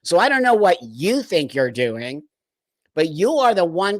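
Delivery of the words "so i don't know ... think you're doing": normal talking voice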